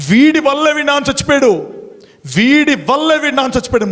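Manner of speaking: high, fast accusing yell; elder shouting. Retold or imitated. Elder shouting blame at the monk at the pyre. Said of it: "మూడు సార్లు గట్టిగా అరిచాడు".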